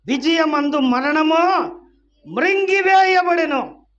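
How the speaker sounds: high, loud, drawn-out cry; full-force scripture proclamation. Real, not acted. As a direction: shout the verse out in victory.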